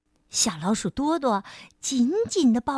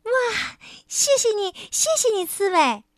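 high, gushing little mouse; overjoyed and grateful; delighted thanks; 9 semitones higher, 3 dB louder than usual. Acted. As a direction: squeal your thanks.

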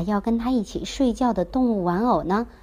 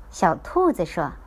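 ordinary delivery

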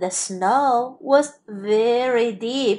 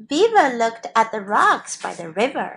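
usual speaking voice

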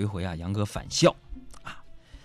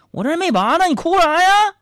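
very high, loud, mock-alarmed yelp; startled dentist; 10 dB louder than usual. Dentist to sobbing patient, hammed-up startled. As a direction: protest in shock at the crying patient. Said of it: "给大夫吓了一跳啊".

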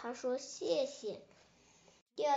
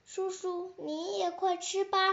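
put-on higher, childlike urging; eager little dinosaur; 7 semitones higher than usual. Little dinosaur invites uncle to share the fruit, eagerly. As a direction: offer food in a high child's voice.

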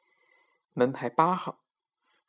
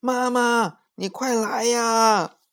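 high, slow call for mom; boy calling higher and slower; 10 semitones higher, 4 dB louder, slower than usual. A narrator's reading. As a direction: plead loudly for mom. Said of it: "一边跑一边叫".

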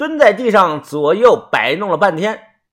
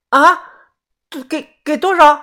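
high, loud startled stammer; shocked disbelief; 11 semitones higher, 3 dB louder than usual. Acted. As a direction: stumble over the question in high-pitched shock. Seller hears absurdly low offer. (尖起嗓子，吃惊结巴地问)

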